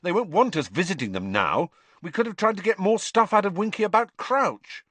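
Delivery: mildly angry Ron. Acted. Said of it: "said Ron angrily".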